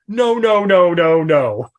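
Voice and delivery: string of no's, starting high and falling; loud, mock-horrified refusal; appalled denial. Recoiling from the stepdaughter theory, appalled.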